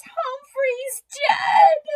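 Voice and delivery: very high, slow wailing cry; little girl sobbing, loud and drawn out. A narrator's reading. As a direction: burst into tears on the words. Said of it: "she sobbed".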